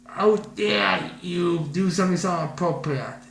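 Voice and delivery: seethe through every word; shaking with anger. Acted. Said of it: "when I came and said".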